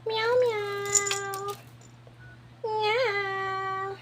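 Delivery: gentle high meowing; soft cat meow. Acted. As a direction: meow softly like a kitten. Imitating the cat softly.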